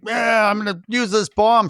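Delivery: imitating Bert. Podcaster acting out Bert's plan for the co-host, imitating. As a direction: put on an eager bomb-thrower's voice. Retelling a film character's bold plan.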